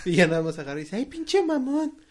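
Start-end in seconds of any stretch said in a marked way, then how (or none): 1.0-2.0 high, slow whiny comeback; mocking retold retort. Retelling the moviegoer's comeback, high mocking voice. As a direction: mimic her insult, high-pitched and drawn out.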